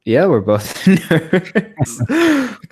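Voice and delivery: laughing through the words; slow, giggling admission. Real, not acted. Admitting being nerds, laughing.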